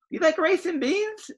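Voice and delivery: squeaky small dog voice. Acted. Small chihuahua asks.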